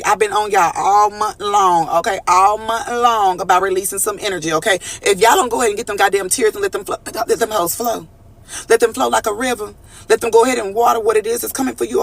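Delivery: ordinary talk, nothing marked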